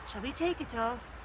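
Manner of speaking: ordinary talk, nothing marked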